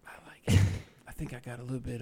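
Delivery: sly breathy whisper; teasing whispered joke; whispered aside. Real, not acted. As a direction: whisper it like a naughty secret. Joking aside over photo.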